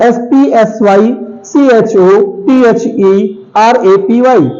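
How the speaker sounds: normal talking voice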